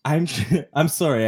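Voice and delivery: apologizing through laughter; loud giggly laughing. Real, not acted. Laughing fit mid-apology.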